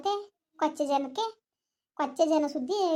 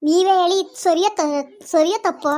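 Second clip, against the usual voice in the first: higher and much louder demand; indignant shout; 4 semitones higher, 8 dB louder than usual. Acted. Woman demanding that the elders judge right or wrong, shouting.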